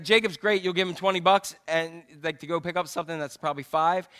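normal talking voice

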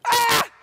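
high loud scream; danger scream. Shown as a demonstration. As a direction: scream in fright. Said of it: "when we're in great danger".

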